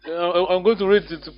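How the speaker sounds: ordinary delivery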